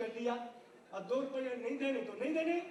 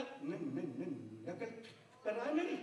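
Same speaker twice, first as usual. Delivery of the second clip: low halting न- stutter; stammering constable; 9 semitones lower, 3 dB quieter than usual. Retold or imitated. Constable accusing the villager of mocking, stammering. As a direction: trip over न- before नकल. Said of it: "सिपाही बोला".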